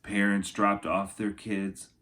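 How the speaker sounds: storybook narration for kindergartners; lilting rhyming picture-book voice; reading to children. Acted. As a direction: read it like story time for little kids. Reads picture book aloud.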